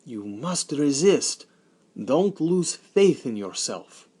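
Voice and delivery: a father urging a friend on; encouraging. Acted. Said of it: "my father tried to encourage him".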